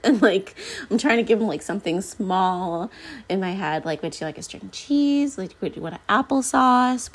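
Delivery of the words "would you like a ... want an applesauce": lightly acted high-pitched offer to a child; higher, singsong mom voice, retold; coaxing parent. Mother coaxing child with snack offers.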